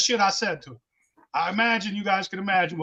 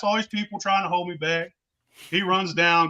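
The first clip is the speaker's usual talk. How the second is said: ordinary talk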